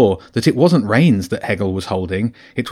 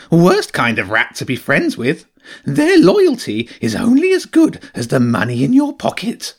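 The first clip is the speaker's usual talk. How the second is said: high, stern hedgehog voice; 6 semitones higher than usual. Acted. Hedgehog warning the girl about rats, stern and high. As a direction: warn her off them, disapproving. Hegel warns Jenny.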